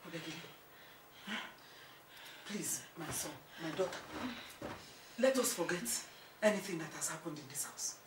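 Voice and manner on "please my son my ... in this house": soft, humble appeal; conciliatory plea. Acted. Mother asking son and wife's forgiveness, soft plea. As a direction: gently ask them to make peace.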